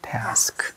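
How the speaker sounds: task whispered as a single syllable; just air, task in one beat. Shown as a demonstration. Teacher whispering task to show one syllable. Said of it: "이렇게".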